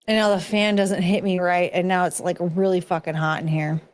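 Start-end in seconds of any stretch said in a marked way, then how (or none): none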